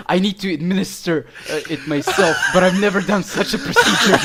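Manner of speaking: loud, hurried mock doctor, laughing; doctor through laughter. Retelling the doctor's solemn excuse, laughing loudly. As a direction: declare it loudly while cracking up.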